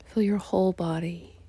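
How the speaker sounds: ordinary talk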